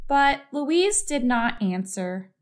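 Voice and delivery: ordinary delivery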